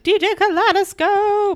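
high, slow announcer call; hammed-up DJ hype name. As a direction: announce yourself like a club DJ. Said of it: "and I was like".

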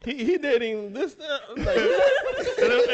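high, giggling through the words; can't get it out for laughing; laughing disbelief. Retold or imitated. Retold gossip, cracking up.

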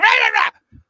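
sudden high yelled rah rah rah; imitated sudden yell. Mocking a caller with Tourette's, sudden high yell. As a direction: blurt it out high and loud, mimicking a tic. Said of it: "they use foul language just out of nowhere".

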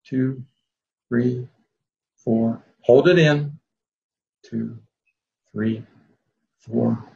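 calm guided breathing cues with long pauses; slow breath counting. Acted. Leads a breathing exercise.

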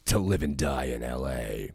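deep, slow trailer voice; movie trailer voice. Acted. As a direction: boom the title like a trailer. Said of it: "God, that's a good voice".

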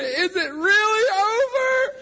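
very high wailed plea, drawn out and loud; hammed-up blubbering squeal; mock crying. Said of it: "he's crying, he's weeping".

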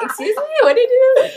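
ordinary delivery

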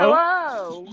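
loud, high welcome; loud greeting. Real, not acted. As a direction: call out a hearty hello. Greeting late guest loudly.

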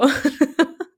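squeaky high laugh; high burst of laughter. Real, not acted. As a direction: let out a high giggle. Laughs at own quote. (hohes kicherndes Lachen)

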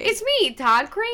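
high, loud creepy self-introduction; hammed-up creepy killer announcing himself; serial killer energy. Co-host parodying the actor's video, hammed-up serial killer. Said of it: "it was big serial killer energy".

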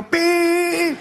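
high, hammed-up Neapolitan curse from behind; mock Neapolitan insult. Angry Neapolitan driver behind curses at the red light, mock Neapolitan insult.

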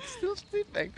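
tiny, high, hushed voice; mock sleeping aside, very soft and high; imitated sleeper.

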